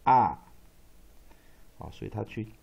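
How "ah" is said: "啊" as a loud, open ah; short O sound. Shown as a demonstration. Teacher sounding out the short vowel, loud isolated "啊". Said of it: "short vowel O".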